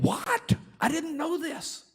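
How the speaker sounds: high, startled disbelief; shocked gasp. Retold or imitated. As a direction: jump in shock at the news. Abigail shocked.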